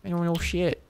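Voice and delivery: a hint of a clueless kid; silly kid voice. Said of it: "I don't know why I'm using this voice".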